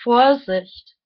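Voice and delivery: deliberate, declaimed phrases, each set apart; reading aloud. Real, not acted. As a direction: read your written thoughts out like solemn maxims. Reads own written thoughts aloud.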